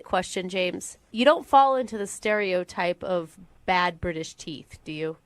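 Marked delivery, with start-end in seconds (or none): none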